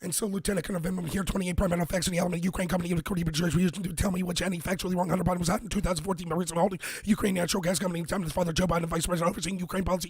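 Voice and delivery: very fast rattled reading; debate speed reading. Shown as a demonstration. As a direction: spread it like a debater, nonstop. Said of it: "Here's how you would read evidence in debate".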